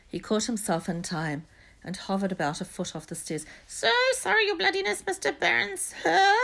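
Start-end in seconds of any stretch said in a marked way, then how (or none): 3.7-6.3 very high, loud, fawning poltergeist; greasy. A narrator's reading. Peeves apologising to the supposed Baron, greasy fawning. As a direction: grovel in a squeaky, oily voice. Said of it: "he said greasily".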